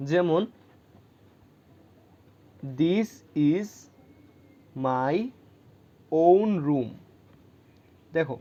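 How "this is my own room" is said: each word set apart, slow, with the weight on own; emphatic own. Shown as a demonstration. Teacher demonstrating the emphatic adjective, stressing own. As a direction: spell it out word by word and lean on own. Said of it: "জোর দিয়ে বলতেছি".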